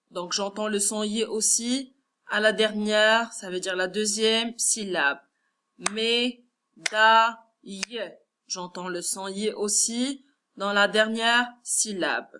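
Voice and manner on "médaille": Médaille broken as mé, da, ille; split into syllables. Shown as a demonstration. Teacher counting syllables for pupils, Médaille split in three. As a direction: pause after each syllable of Médaille. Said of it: "On va compter ensemble les syllabes".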